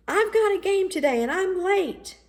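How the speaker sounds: put-on high excited boy's call back; yelled back. A narrator's reading. Michael running to his game, yelling back to Daddy. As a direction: holler it over your shoulder. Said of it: "Michael yelled back".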